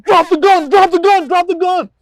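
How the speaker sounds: very high, loud repeated yell; retold screaming. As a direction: scream the order over and over. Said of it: "I'm yelling, screaming, I'm like".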